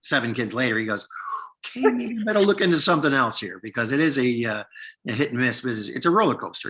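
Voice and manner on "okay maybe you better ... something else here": pitched well up, imitating a rattled dad; high-voiced mock realization from a father; dad's alarmed realization. Dad with seven kids rethinking his acting career, mock alarm.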